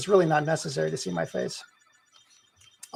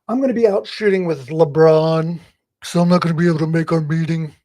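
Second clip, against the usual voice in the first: loud busy show-off voice; Hollywood big shot; 6 dB louder than usual. Retold or imitated. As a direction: brag like a busy Hollywood type. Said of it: "he's like".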